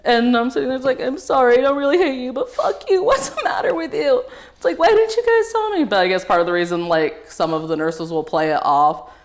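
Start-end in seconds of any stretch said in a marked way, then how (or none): 1.0-5.8 high-pitched apology rising into abuse; upset outburst. Retold or imitated. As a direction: wail at the nurse, climbing higher. Re-enacts outburst at nurse after procedure.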